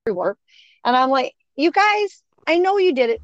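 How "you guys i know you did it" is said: high-pitched, mildly exasperated telling-off; mock scolding of mischief-makers; retold scolding. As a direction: call them out, pitched well up.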